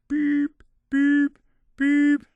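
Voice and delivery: high, steady electronic beeps; imitated bomb beeps. Synth faking he's rigged to explode, high beeping. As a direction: mimic a countdown beeper, three even tones. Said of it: "started going".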